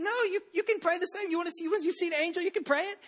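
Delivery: high, coaxing parent voice. Retold or imitated. Father coaxing his young son at bedtime, high and coaxing.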